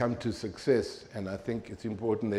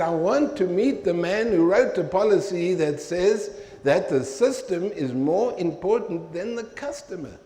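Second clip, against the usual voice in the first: higher and louder, building outrage; indignant demand; 7 semitones higher, 5 dB louder than usual. Retold or imitated. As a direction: lay down the point forcefully. Final demand to supervisor.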